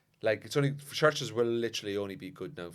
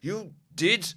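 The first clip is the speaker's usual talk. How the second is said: slow, higher and louder stern priest; 8 semitones higher, 9 dB louder, much slower than usual. Retold or imitated. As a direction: drag each word out like a disappointed canon. Priest scolding boy after mass.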